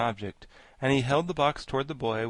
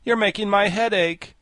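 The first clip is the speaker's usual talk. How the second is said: high, loud complaint; pained, raised-voice objection; Pumpkinhead crying out; 10 semitones higher, 8 dB louder than usual. A narrator's reading. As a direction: cry out in protest. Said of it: "cried the Pumpkinhead".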